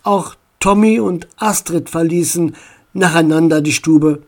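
ordinary delivery, nothing marked

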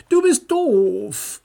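put-on slow, loud childish retort; angry retort. A narrator's reading. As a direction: snap back at your brother. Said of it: "gab Tommy zurück und sah ihn wütend an". (wütend, langsam und laut)

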